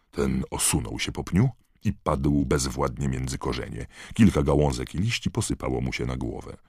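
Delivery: ordinary delivery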